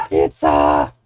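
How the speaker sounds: loud happy cry of pizza; excited shout. Real, not acted. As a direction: announce the pizza with joy. Pizza comes out of oven.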